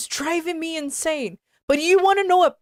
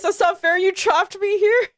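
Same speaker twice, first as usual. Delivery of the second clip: higher, louder laughing protest; laughing complaint; 7 semitones higher, 3 dB louder than usual. Real, not acted. To Bryce who trapped her, complaining while laughing. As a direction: laugh through the accusation.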